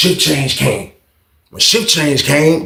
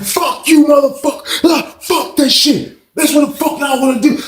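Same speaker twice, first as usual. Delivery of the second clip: imitated blacking out; 13 semitones higher than usual. Inmate cursing out the guard, imitated furious outburst. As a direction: act out an inmate exploding in rage. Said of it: "He just start blacking out".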